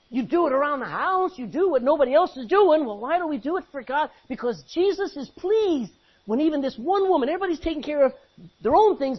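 loud, high preacher's push; preaching at full force. Acted. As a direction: drive it home to the congregation.

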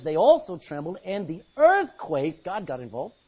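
normal talking voice

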